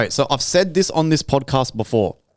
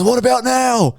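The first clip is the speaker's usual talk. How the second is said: high mocking taunt, much higher voice; menacing villain taunt; 11 semitones higher, 3 dB louder than usual. Hopper taunts the grasshopper after burying him, high mocking voice. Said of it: "He goes".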